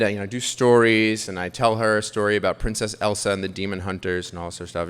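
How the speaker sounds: normal talking voice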